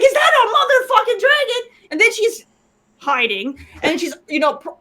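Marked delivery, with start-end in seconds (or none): 0.0-1.6 higher-pitched mock alarm; imitated shock. Player mimics the woman spotting a dragon, shocked. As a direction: imitate a character startled by a dragon. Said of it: "jumping around with the emotions".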